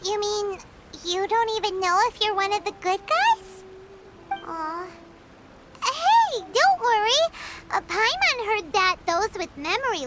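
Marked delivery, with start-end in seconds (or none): 4.3-4.9 soft, much lower coo of pity; a quiet, sorry little aw; sympathetic aw. Acted. Sympathy for the sad overseer.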